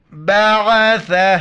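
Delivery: بعثہ drawn out slowly in recitation, every letter sung through; recited in full. Shown as a demonstration. Teacher recites بعثہ for students, slow and full. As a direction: chant بعثہ long and melodic, as tilawat. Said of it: "صرف آخر میں ہا کا sound آئے گا".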